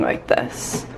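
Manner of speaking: hushed whisper. Real, not acted. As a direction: whisper it like a secret. Excited aside about bathroom storage.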